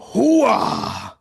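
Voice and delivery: loud gung-ho soldier. Retold or imitated. Pacino voice after actor names.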